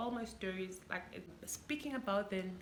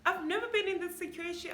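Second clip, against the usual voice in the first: high, loud, laughing disbelief; stunned laughter; laughing shock; 12 semitones higher, 12 dB louder than usual. Real, not acted.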